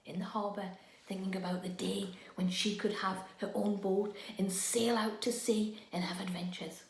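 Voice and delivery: soft, dreamy bedtime narration; gentle hushed story voice; hushed storytelling. A narrator's reading. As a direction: draw them in with a hush.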